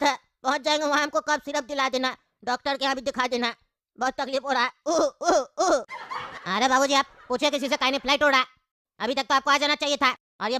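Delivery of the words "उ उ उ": three hoarse acted coughs; coughing. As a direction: cough it out like a sick old man. Sick old father coughing. (बीमार बूढ़े की खाँसी)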